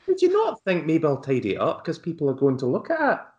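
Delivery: high, scolding Scottish host; Lorraine Kelly impression. Host scolds the artist about his messy bed in a Scottish accent. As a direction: tell the artist off like a chatty aunt. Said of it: "That's exactly what Lorraine Kelly would say".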